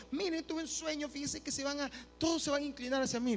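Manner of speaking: put-on high, eager boy's voice; boastful young dreamer. Joseph telling his brothers his dream, boastfully eager. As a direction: brag about it in a high, naive voice.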